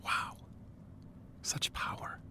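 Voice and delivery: hushed, mock-awed whisper. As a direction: whisper it in awe.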